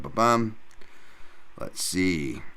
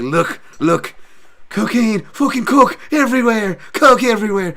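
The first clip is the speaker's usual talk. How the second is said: high, loud Irish caricature; coked-up McGregor; 12 semitones higher, 8 dB louder than usual. Retold or imitated. Mocking a fighter showing off, high loud coked-up Irish voice. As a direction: shout it like a wired party bore. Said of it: "doing that Buzz Lightyear, like, to Woody".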